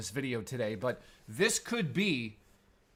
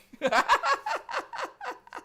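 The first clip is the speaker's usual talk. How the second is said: a burst of high laughter, real; high cackling laugh; 3 dB louder than usual. Real, not acted. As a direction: cackle at your own mistake. Laughing at his own slip.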